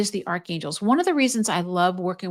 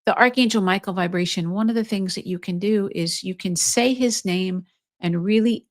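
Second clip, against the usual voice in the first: ordinary talk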